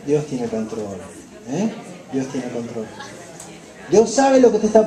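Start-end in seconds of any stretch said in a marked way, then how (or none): 0.0-2.8 comforting words, low and soft; reassuring whisper-soft. Shown as a demonstration. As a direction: soothe a grieving friend, low and gentle.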